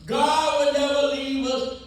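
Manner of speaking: ordinary talk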